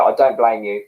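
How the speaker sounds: ordinary talk, nothing marked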